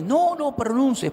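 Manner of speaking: high, loud, slowed alarmed shout; shouted warning. Retold or imitated. Young man begs the preacher not to say the name, shouting high and loud. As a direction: yell it like a frightened plea. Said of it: "pero a gritos".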